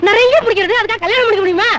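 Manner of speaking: high-pitched flustered outburst; exasperated protest. Acted. Director turning down actress's marriage wish, exasperated.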